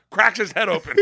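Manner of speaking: normal talking voice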